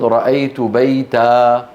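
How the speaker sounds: رأيت بيتا slowly, ending on long -aa; alif replacing tanween. Shown as a demonstration. Teacher shows accusative stopping, بيتا as baytaa. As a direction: hold the open aa of بيتا with no n. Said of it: "فيعوضون عن التنوين بألف".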